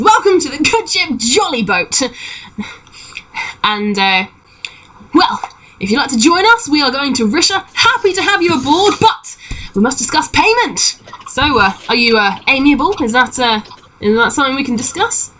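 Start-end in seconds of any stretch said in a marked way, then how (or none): 0.0-2.0 high, showy halfling greeting; excited welcome. Acted. Halfling repeating his welcome to passengers, excited high voice. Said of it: "in the air excitedly and says". 5.1-15.3 higher-pitched, lilting negotiator; eager halfling pirate. Acted. Halfling raising payment with passengers, high eager voice.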